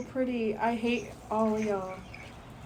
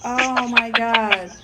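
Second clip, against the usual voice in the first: slow, louder amazed gasp; drawn out in amazement; overwhelmed awe; 8 dB louder, much slower than usual. Real, not acted. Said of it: "Calm down".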